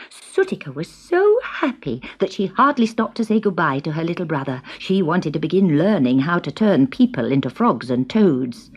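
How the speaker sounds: usual speaking voice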